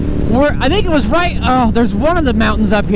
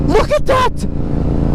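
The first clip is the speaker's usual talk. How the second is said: awed; much slower than usual. Real, not acted. Awed at waterfall.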